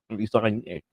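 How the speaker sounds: usual speaking voice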